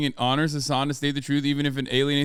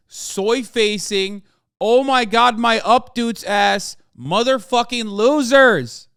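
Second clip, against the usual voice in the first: builds from annoyed to a much higher, louder screaming rage; furious rant; 8 semitones higher, 6 dB louder than usual. Acted. Streamer yelling at fans who keep sending clips, building to a furious scream. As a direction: lose it completely, louder with every line.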